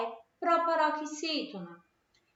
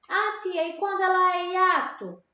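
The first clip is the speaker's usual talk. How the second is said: lightly voiced pupil, higher and louder; pupil's question; 7 semitones higher, 5 dB louder than usual. Pupil interrupting the teacher to ask about hiato, lightly imitated. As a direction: pipe up like a pupil asking.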